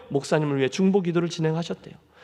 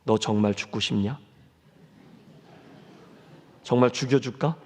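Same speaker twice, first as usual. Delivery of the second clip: voice of the Lord; 7 semitones lower than usual. Retold or imitated. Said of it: "주님이 이렇게 말씀하셨습니다".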